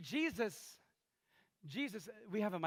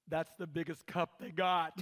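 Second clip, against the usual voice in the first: slow, loud, condescending; condescending mom; 3 semitones lower, 3 dB louder, slower than usual. Retold or imitated. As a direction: talk over the kid to him. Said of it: "the mom says".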